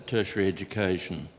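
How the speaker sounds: ordinary talk, nothing marked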